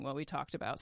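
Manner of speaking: ordinary talk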